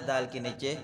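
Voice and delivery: usual speaking voice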